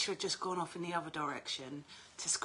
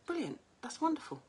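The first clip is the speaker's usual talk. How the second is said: bright reply; 10 semitones higher than usual. Retold or imitated. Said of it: "I was like".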